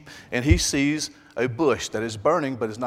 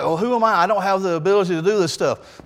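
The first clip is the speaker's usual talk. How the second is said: whining Moses; 6 semitones higher, 4 dB louder than usual. Acted.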